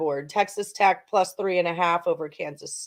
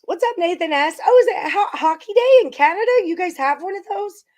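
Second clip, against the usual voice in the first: excited greeting; 12 semitones higher, 5 dB louder than usual. Real, not acted. Greeting chat viewer, hockey day excitement.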